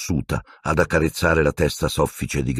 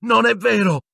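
high-pitched, furious "Non è vero!"; screamed denial. A narrator's reading. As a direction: shriek it in disbelief. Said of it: "urlò Harry".

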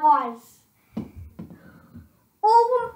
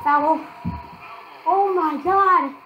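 ordinary talk

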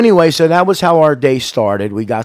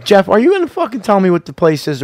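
normal talking voice

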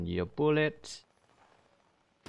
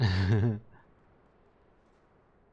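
scared giddy laughing. Real, not acted. Laugh during fight.

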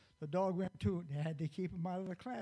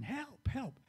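higher-pitched mock cry for help; calling for help; 9 semitones higher than usual. Girl in the water calling for help, high mock cry. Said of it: "she started hollering".

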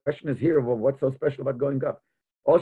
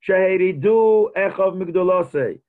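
reads each word slow and loud; slow emphatic quote; 3 semitones higher, 4 dB louder, slower than usual. Acted. Teacher reciting Rashi's words to students, slow and emphatic. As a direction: lean into the quote as a text.

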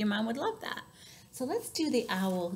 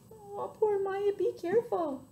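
pitying, cooing; 11 semitones higher than usual. Pretend. Reader comforting the bumped elephant, high and sympathetic. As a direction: fuss over a hurt little one.

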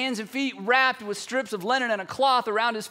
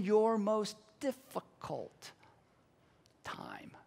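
slow right down, fading to a whisper; drawn-out words sinking into near silence; hushed promise; 8 dB quieter, much slower than usual. Acted. Preacher as Jesus promising glory in hard times, hushed.

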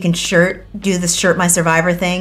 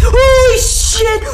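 startled scream; 14 semitones higher, much slower than usual. Real, not acted. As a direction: jump scare yell. Jump scare by Billy.

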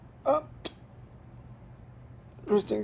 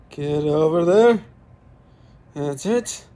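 ordinary delivery

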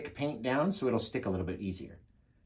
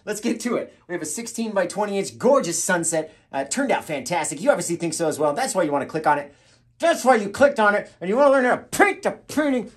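brisk pitch growing higher and louder; hyped presenter; 7 semitones higher, 6 dB louder than usual. Acted. Host telling viewers why they clicked, increasingly hyped. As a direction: build the sales patter to a peak. Performed intro take building up.

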